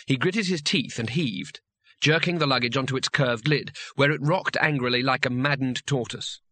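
plain reading voice

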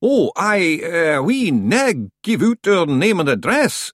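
a rustic Scot at the door; Scottish-ish stranger. A narrator's reading. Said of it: "it said quickly".

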